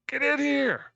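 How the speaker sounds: high, loud shouted order; full-voiced, high-pitched call for backup; yelling. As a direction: yell a summons across the room. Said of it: "and yells".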